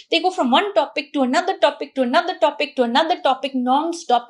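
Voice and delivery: rapid list run together without pauses; fast, nonstop. Shown as a demonstration. Describing confused fast talkers who hop topics, hurried and nonstop. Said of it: "people who talk fast".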